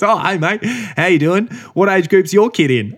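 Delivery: a cheery parent greeting a fellow dad; school-sports dad greeting a stranger, cheerfully; chirpy oblivious dad. Retold or imitated.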